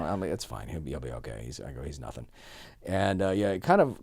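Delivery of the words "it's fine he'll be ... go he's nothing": low, soft, dismissive reassurance; calm. Retold or imitated. Turning down guests' offer of help, calm. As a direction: drop low and quiet, brush it off. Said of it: "I was gonna handle it calmly".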